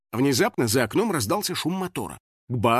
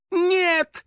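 very high, drawn-out panicked cry; frightened; 3 dB louder than usual. A narrator's reading. House spirit flees the hunter, frightened. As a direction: shoot the pitch way up in fright. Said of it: "испугался домовой".

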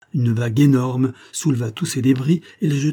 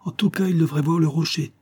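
raised, insistent exclamation; exclaiming; 6 semitones higher than usual. A narrator's reading. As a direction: protest it in a higher voice. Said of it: "s'écria le lieutenant". (exclamation insistante, plus aiguë)

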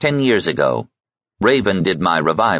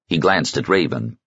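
usual speaking voice

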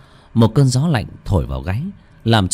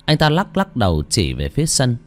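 ordinary delivery, nothing marked

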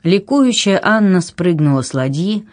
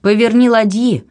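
high-pitched imperious order; raised, authoritative voice; commanding; 7 semitones higher, 3 dB louder than usual. A narrator's reading. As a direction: repeat it as a firm order. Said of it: "властно повторила Анна".

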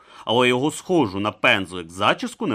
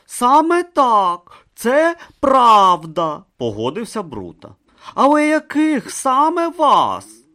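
higher, slow and careful; slow earnest Brutha; 14 semitones higher, 8 dB louder, slower than usual. Acted. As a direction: weigh every word slowly. Brutha slowly agreeing, questioning.

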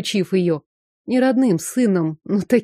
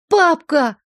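put-on high happy child squeal; joyful squeal; 8 semitones higher, 3 dB louder than usual. A narrator's reading. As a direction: squeal it with delight. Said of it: "Радостно взвизгнул мальчик".

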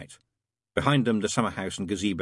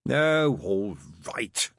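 reluctant, miserable giving in; wretched; much slower than usual. A narrator's reading. Crowley giving in to a glaring Aziraphale, wretchedly. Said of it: "said Crowley wretchedly".

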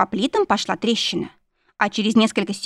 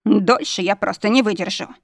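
plain reading voice

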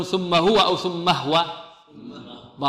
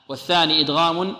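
ordinary delivery

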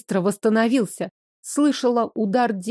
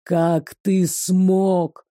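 very slow, hissing demon voice; 2 semitones lower, much slower than usual. Acted. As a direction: drag each word out like a demon.

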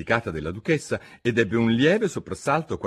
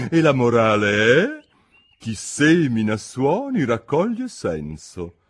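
higher, louder, pompous duchess; 4 semitones higher, 5 dB louder, slower than usual. A narrator's reading. Duchess lectures Alice, pompous and higher. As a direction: preach a moral she cannot recall.

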